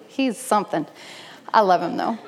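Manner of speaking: ordinary talk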